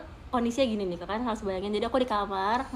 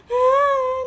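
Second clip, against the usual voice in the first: tearful groan; 12 semitones higher, 8 dB louder than usual. Retold or imitated. Recalling crying with sister.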